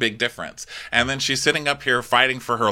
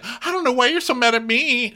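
much higher mock innocence; a high, wounded whine; mocking Kyle; 13 semitones higher than usual. Host mocking Kyle's high innocent whine to Kathy. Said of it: "Kyle's like".

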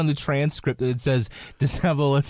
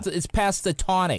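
ordinary talk

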